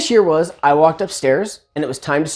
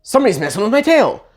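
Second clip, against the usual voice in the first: annoyed iguana voice; 4 semitones higher than usual. Retold or imitated.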